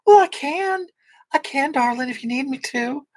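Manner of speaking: hammed-up Southern belle lilt; Southern accent. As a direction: sweet-talk them in a high Southern accent. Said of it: "Can you do a Southern accent for us?".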